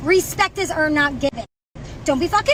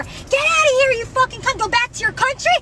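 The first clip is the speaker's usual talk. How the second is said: retold yell; 7 semitones higher than usual. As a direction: fling the insult back as his yell. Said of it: "He goes".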